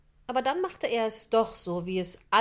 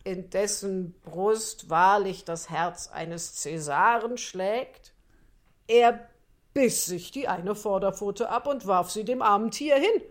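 sly fox; 5 dB louder than usual. Acted. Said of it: "der Fuchs stammelte und stammelte".

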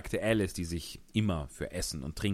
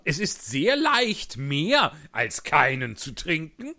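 loud, excited Hatter voice; 8 semitones higher, 7 dB louder, slower than usual. Acted. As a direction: tell it like an agitated Hatter. Hutmacher als Figur. (lauter, aufgeregter Hutmacher)

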